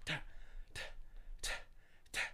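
short spaced percussive hits; one sharp "ta" per jab, with gaps; voiced blow sound effects; imitated elbow jabs. Imitating elbows thrown in the side.

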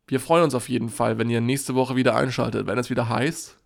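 upbeat, loud sign-off; show outro. Acted. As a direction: announce next episode to the audience. Outro announcement.

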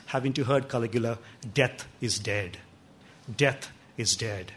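quiet certainty, no fear at all; calm quiet defiance. Acted. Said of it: "looks at Caligula and says".

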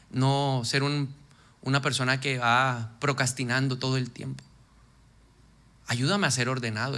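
prayer. Shown as a demonstration. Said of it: "Pues pídele poder".

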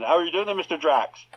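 mock Somerset accent, Bond meeting the villain; a Cornish Bond greeting Drax, hammed-up; West Country Bond. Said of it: "or a, a Somerset Bond".

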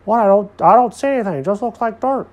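loud, high, goofy protest; baffled Alfred character. A narrator's reading.

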